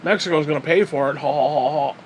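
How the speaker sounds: lightly put-on wall-building zealot; mildly mocking impression of a border hardliner; mocking evangelical. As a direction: parody a chanting evangelical, ending in fake laughter. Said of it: "as evangelical".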